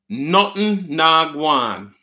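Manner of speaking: "Nothing na gwan" drawn out very slowly, word by word; slow teaching repeat. Shown as a demonstration. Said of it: "and you say".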